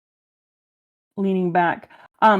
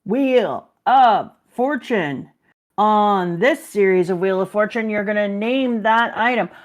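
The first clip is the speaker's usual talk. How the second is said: game show host. Acted. Joking about a host lookalike, game show host voice. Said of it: "kinda looks like Pat Sajak".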